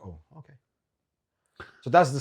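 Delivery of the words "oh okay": taken-aback murmur. Retold or imitated. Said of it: "I was like".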